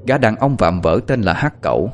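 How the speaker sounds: plain reading voice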